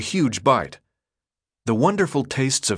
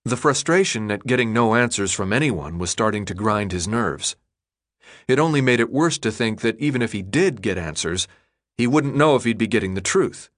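ordinary delivery

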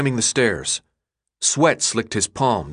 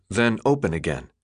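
ordinary delivery, nothing marked

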